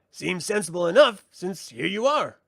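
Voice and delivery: high, loud and sassy little brownie; cheeky brownie. Acted. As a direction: greet him like a pert sprite. Brownie Rule reasons.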